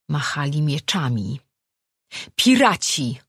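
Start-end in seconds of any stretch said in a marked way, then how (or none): 2.3-3.2 high, loud shout of warning; alarmed yell. A narrator's reading. Boy spots pirates from the mast, yelling. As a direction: scream the warning to the crew. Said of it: "wrzasnął Darm".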